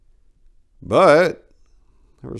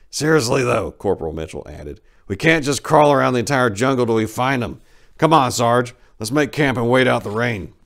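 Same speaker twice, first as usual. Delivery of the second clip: soldier voice; 6 semitones higher, 8 dB louder than usual. Acted. Voiced character Mitchell speaking.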